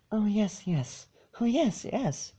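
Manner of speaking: high, soft and flustered; timid pony agreeing hastily. Acted. Fluttershy voiced in story reading.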